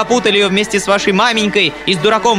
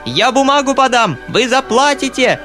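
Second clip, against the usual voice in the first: high, frenzied threats; frenzied shouting; 9 semitones higher, 3 dB louder than usual. A narrator's reading. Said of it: "кричала ... в совершенном исступлении".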